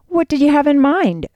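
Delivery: understated annoyance on a lifted pitch; twinge of annoyance. A narrator's reading. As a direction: ask with a slight touch of irritation. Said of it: "with a twinge of annoyance".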